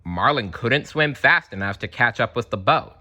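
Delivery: ordinary delivery, nothing marked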